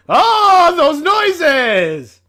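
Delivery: high, very loud cry of disgust; shocked outcry. Real, not acted. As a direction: shriek at the gross noises. Reacting to choking sounds in story.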